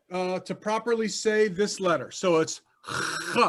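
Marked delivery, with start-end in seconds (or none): 3.3-3.4 Hebrew ch rasped from the throat; throaty ch. Shown as a demonstration. Teacher demonstrating the Hebrew ch to the class, rasped from the throat. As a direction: scrape the ch deep in the throat. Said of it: "You gotta bring it from inside".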